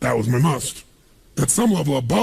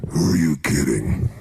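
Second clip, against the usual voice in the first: much lower, slow, sneering inner voice; doubting inner voice; slower than usual. Retold or imitated. Inner voice sneering at your big goals, low and slow. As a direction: sound like the voice of doubt mocking you. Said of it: "this voice in your head goes".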